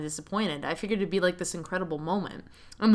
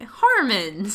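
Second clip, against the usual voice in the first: mock outraged shout of his name; protesting call; 2 semitones higher, 7 dB louder than usual. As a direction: scold him playfully.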